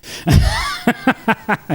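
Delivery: high laugh in quick bursts; real rattling chuckle, pitched high; amused laugh. Real, not acted. Reacting to the YouTube video, high amused laugh.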